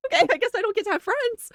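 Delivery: high, giggly fake-sulky quote; hammed-up wounded friend; mock hurt, giggling. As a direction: play his sulk while cracking up. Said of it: "He was like".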